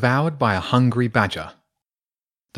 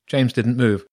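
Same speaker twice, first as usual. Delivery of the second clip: ordinary delivery, nothing marked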